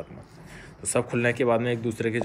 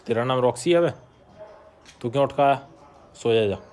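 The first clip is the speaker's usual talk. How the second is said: raised voice, teasing telling-off; mock scolding; 3 semitones higher, 5 dB louder than usual. Real, not acted. Owner to the dog that got up, mock scolding. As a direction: tell the dog off and send it back to sleep.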